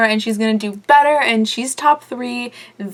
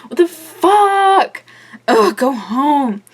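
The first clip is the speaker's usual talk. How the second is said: outraged; 5 semitones higher, 6 dB louder, much slower than usual. Real, not acted. Outraged at Kennedy's insults.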